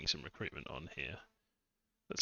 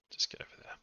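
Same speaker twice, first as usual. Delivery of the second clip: whisper; 7 dB quieter, faster than usual. Acted. Whispered aside to unit.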